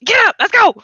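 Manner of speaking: wake-up shout. Retold or imitated. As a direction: bark the kids out of bed. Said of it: "I'm like".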